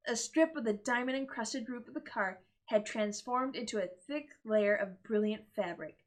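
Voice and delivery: plain reading voice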